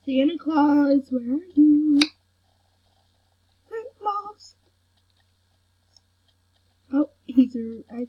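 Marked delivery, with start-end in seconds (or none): 0.1-2.0 calling out. Real, not acted. Player searching for Santa Claus, calling out. 3.7-4.6 high, soft call; singsong calling. Real, not acted. Player searching for Santa Claus, singsong calling.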